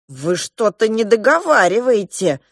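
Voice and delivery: desperate. Acted. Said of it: "с отчаянием сказала я".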